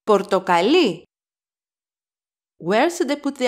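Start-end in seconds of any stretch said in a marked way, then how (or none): 0.1-1.0 Πορτοκαλί stressed on the final syllable, λί; stress on last syllable. Shown as a demonstration. Teacher contrasts the color with the fruit, Πορτοκαλί stressed on final λί. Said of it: "The last syllable of the word".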